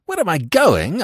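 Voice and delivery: high, loud, indignant retort; impassioned cry. A narrator's reading. Narrator answers Smith's challenge, crying out in agitation. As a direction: cry it back in disbelief. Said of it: "I cried".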